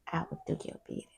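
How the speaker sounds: quick, soft unvoiced reply; a meek servant whispering his obedience; mock servant whisper. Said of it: "he said".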